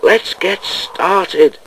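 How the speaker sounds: slow, insistent push to get going; impatient. A narrator's reading. Cold engine eager to leave, impatient. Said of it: "he shivered impatiently".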